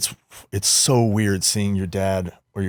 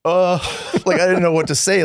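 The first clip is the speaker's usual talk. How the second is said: laughing; 10 semitones higher, 5 dB louder than usual. Real, not acted. Overwhelmed by dad's praise.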